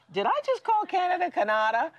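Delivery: amused high-pitched disbelief at her own slip; cracking herself up. Retold or imitated. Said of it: "cracking myself up that I said".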